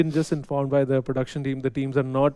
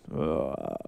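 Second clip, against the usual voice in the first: deep husky grunt; 12 semitones lower, 7 dB quieter than usual. Retold or imitated. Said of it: "really went, like, down inside your lungs".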